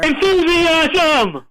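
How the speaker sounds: high, drawn-out hype cry; stretched, high-pitched cheer; mock enthusiasm. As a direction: burst out with over-the-top enthusiasm. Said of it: "he brings the enthusiasm".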